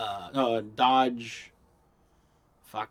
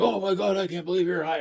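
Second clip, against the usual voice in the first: higher mock shock at the news; shocked brother; 7 semitones higher than usual. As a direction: brother aghast, voiced a pitch up. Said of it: "he's like".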